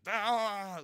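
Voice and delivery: loud, high open-mouthed wail; mock infant outburst; imitated baby squeal. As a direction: holler like an easily amused baby. Imitating babies reacting to jingled keys.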